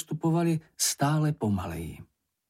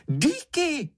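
piercingly high squeal; one excited squeaky exclamation; Čenich crying out; 3 dB louder than usual. A narrator's reading. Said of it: "vykřikl". (nadšený pisklavý výkřik)

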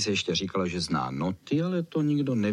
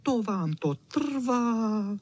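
high, slow, drawn-out complaint; grumbling chow chow; 10 semitones higher, much slower than usual. A narrator's reading. Chow chow chiding the slow pickers, high grumble. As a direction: stretch it out in a high dog voice. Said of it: "vrčel Č".

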